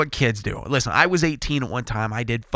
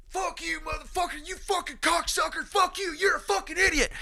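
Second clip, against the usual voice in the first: much higher, strained imitation of a yelling father; mock screaming dad; 8 dB quieter than usual. Racer imitates the kid's dad screaming at him from the berm, in a much higher mock yell. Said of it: "his dad is fucking screaming".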